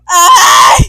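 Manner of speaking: loud, high scream; exasperated scream. Acted. Vlogger after apologizing for being away so long, exasperated scream. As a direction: let out a big frustrated yell.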